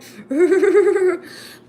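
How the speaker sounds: high burst of laughter; loud laugh. Real, not acted. Vlogger cracking up at her sauna story.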